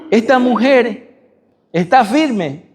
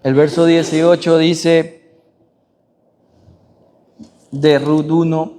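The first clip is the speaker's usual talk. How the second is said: ordinary talk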